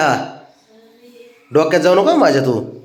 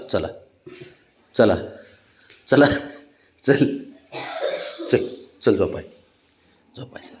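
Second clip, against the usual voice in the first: normal talking voice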